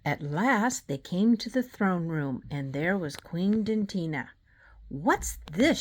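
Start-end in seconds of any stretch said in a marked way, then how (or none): 4.9-5.8 shrieking. A narrator's reading. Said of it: "she shrieked".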